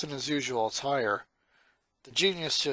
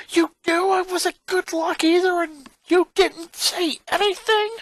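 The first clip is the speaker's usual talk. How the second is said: much higher, louder angry yell; furious boy; 7 dB louder than usual. Acted. Lincoln confronts his sister Lisa, furious yell. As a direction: accuse her at full volume. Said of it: "now furious".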